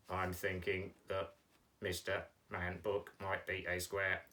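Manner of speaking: low, monotone puppet; puppet Mike's voice. Pretend. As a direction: speak low and soft on one level note. Teacher voices puppet Mike.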